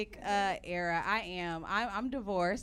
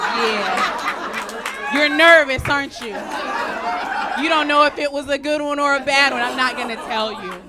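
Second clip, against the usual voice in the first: louder, teasing inner gloat; gloating taunt; 3 semitones higher, 12 dB louder than usual. Retold or imitated. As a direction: savor his panic, mocking him. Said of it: "I just watch the messages come through like".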